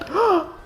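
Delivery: sharp, high theatrical gasp; loud comic intake of breath; mock alarmed gasp. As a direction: gasp in pretend panic. Acting the others' alarm.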